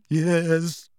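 grandly theatrical self-pity; dramatic, wavering. A narrator's reading. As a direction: intone mystically as a persecuted prophetess. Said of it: "dramatic, wavering voice".

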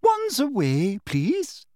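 high, lilting sweet command; sugary high schoolmistress voice; smug teacher. A narrator's reading. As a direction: coo the order primly. Smug teacher instructs class.

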